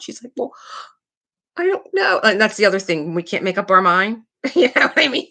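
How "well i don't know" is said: hammed-up childish despair; imitated crying. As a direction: whine it like an upset little girl. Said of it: "she's just crying".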